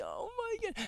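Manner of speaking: high squeak of shock; disbelieving high-pitched oh my god; squeaky gasp. Real, not acted. Stunned reaction, huge pitch jump.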